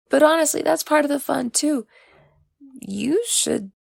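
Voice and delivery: lightly voiced Oriaku.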